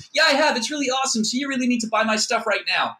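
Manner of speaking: high-pitched, loud mock sales gush; pushy salesperson. LinkedIn seller brushing off an objection, high pushy gushing parody.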